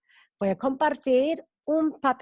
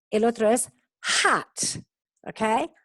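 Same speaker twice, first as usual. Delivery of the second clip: usual speaking voice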